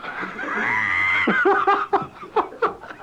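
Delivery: can't hold it in any longer; burst of laughter. Real, not acted. Newsreader finishing the dung story, bursting out laughing.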